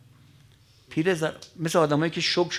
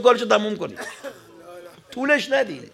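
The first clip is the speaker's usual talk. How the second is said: weeping command; 8 semitones higher, 4 dB louder than usual. Acted.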